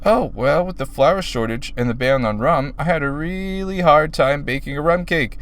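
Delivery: chirpy but hushed explanation; a hint of a cheery pink pony; lightly voiced Pinkie. Pinkie answers Octavia.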